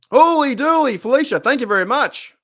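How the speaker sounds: loud, high burst of thanks; excited. Real, not acted. Thanking Felicia loudly for her donation, excited. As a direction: shout the thanks with delight.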